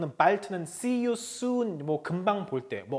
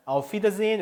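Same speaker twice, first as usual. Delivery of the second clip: Auf Wiedersehen run together quickly; quick everyday Auf Wiedersehen; fast reading; 3 semitones higher, much slower than usual. Shown as a demonstration. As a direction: say it at natural quick speed. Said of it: "빨리 읽으면".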